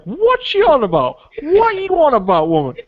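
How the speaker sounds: squeaky confused what are you on about; baffled viewer voice. Retold or imitated. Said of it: "just sitting there like".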